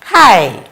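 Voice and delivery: "Hi" said like Japanese hai; "Hi" spoken firm like Japanese yes. Shown as a demonstration. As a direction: say "Hi" as a crisp hai. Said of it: "they will say".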